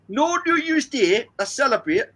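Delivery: high, hammed-up Geordie accent. Shown as a demonstration. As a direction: badly imitate a Geordie, pitch swooping up. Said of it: "That was my Geordie accent".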